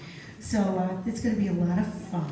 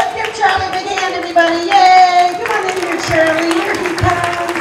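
loud, higher welcoming call; excited welcome; 7 semitones higher, 11 dB louder than usual. Real, not acted. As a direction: hype up the crowd for Charlie. Welcoming Charlie on stage.

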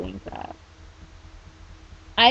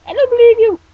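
very high, loud outburst; mock shout; 15 dB louder than usual. Speaker breaks off own chat, sudden mock shout. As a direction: yell it suddenly in disbelief.

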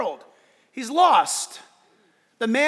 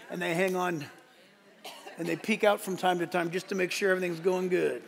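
much softer, lower storytelling voice; soft and hushed; 6 semitones lower, 7 dB quieter than usual. Real, not acted. Preacher gently describing a scared child behind Dad, softly. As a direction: hush down like telling a bedtime tale.

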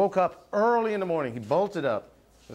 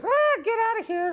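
squeaky falsetto shooing; high cartoon sidekick. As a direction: shoo it off in a very high puppet voice. Mister Goop chases off a monster.

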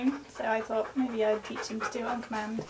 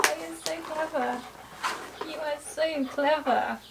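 doting praise; 4 semitones higher than usual. Real, not acted. Doting on the dog.

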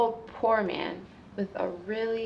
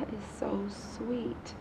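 touched little whisper; 11 dB quieter than usual. Acted. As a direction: breathe it out, moved.

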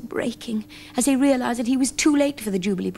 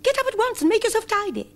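very high squeaky mouse voice; high little mouse giving orders. A narrator's reading. Mice urge the bear.

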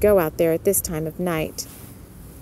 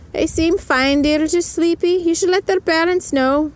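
Missus O'Malley voice; 9 semitones higher than usual. A narrator's reading. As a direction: lift the pitch way up and brighten. Missus O'Malley speaks to Mellie.

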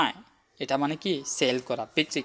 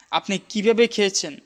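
কিভাবে pushed up high and loud; stress on কিভাবে; 8 semitones higher, 4 dB louder than usual. Shown as a demonstration. Teacher demonstrating an emphatic question with stress on কিভাবে. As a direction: lean hard on কিভাবে, higher and louder. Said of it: "কি শব্দটা একটু বেশি জোর দিয়ে দিব".

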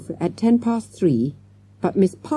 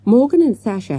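plain reading voice

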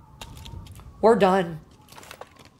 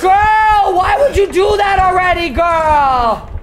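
high, loud shriek of protest; startled shout; 9 semitones higher, 5 dB louder, slower than usual. Real, not acted. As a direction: yell at whoever just made you jump. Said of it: "That scared the shit out of me".

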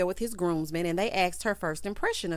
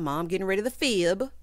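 ordinary talk

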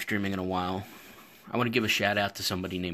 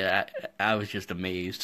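normal talking voice

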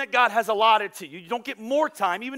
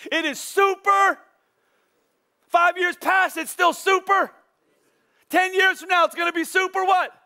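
mocking shout; 9 semitones higher, 4 dB louder than usual. Mocking busy refrain.

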